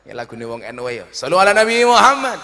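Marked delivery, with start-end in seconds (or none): 1.1-2.4 high, loud call to salawat; rally the crowd with a rising call. Real, not acted. Preacher prompting the congregation to reply, loud devotional call. Calls crowd to recite blessing.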